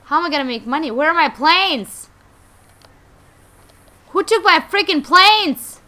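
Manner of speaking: loud, high outburst of outrage; angry shouting. Pretend. Player finding the hangar empty of planes, angry shouting. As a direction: yell it, indignant over a theft. Finds his planes missing.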